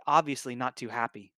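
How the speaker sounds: usual speaking voice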